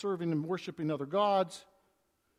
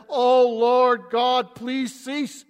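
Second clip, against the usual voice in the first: loud desperate plea; 3 semitones higher, 10 dB louder, much slower than usual. Acted. Amos crying out to God to stop the fire, a loud slow plea. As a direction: stretch out a loud, slow cry of oh.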